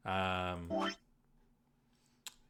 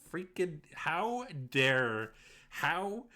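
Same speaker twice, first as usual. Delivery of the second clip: higher, louder, slow outraged how dare; 5 semitones higher, 3 dB louder, much slower than usual. Real, not acted. Streamer upset at a cruel achievement name, outraged cry. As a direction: drag out the outrage, half laughing.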